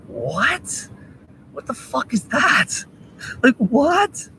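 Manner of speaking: high-pitched, drawn-out disbelief; incredulous. Acted. Appalled at people abusing the homeless, incredulous. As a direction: let the question shoot up in disbelief. Incredulous reaction to abuse story.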